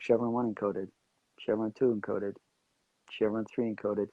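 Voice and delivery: just listing, no performance; like a grocery list. Retold or imitated. Actor gives in to the producer, lines listed like groceries. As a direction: reel the items off evenly, unadorned. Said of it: "it was like a grocery list".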